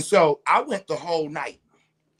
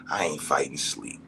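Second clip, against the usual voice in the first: low whisper; hushed low aside; 11 semitones lower, 4 dB quieter than usual. Real, not acted. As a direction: mutter it under your breath.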